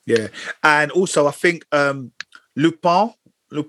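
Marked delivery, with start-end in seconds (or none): 2.6-3.7 French accent. Shown as a demonstration. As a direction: say "Lupin" the French way, loo-PAN. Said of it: "I was trying to speak French".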